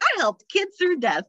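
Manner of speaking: very high-pitched, chipper self-parody; sing-song cheeriness, way up high; so cheery. Retold or imitated. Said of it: "I'm, like, so cheery about it".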